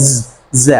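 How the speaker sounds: "Z" as zed with a buzzing z; buzzed Z. Shown as a demonstration. Teacher showing what the sound is like, buzzing "Z". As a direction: hold the zzz, then say "Z" as zed. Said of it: "like সাউন্ডসটা হচ্ছে এরকম".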